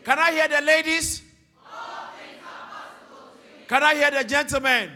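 loud rallying call to read aloud; preaching at full force. Acted. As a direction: fire up the crowd to read together. Rallying the congregation.

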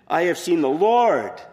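Mary announcing to the disciples, emphatic. Acted. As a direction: announce it with full joyful force. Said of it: "is emphatic".

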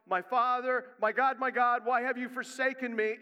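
loud, high anguished cry; a preacher voicing Jesus' loud cry from the cross. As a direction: cry it out to God. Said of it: "having to cry out".

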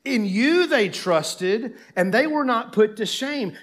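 high, loud, slowly stretched sarcasm; bitterly sarcastic recitation, drawn out. Acted. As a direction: throw their good fortune back at God. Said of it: "you can almost feel the sarcasm".

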